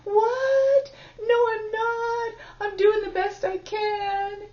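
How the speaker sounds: put-on a hurt child protesting through tears; innocent little inner child. Shown as a demonstration. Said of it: "little part over here that's innocently going".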